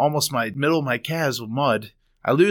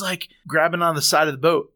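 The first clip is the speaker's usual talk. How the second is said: normal talking voice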